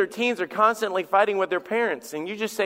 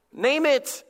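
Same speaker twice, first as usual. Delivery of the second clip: NAME IT pitched high, urgently; emphatic command; 6 semitones higher than usual. Real, not acted. Preacher urging congregation to name their sin, emphatic command. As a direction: drive "Name it" home in a raised pitch. Sermon refrain driven home.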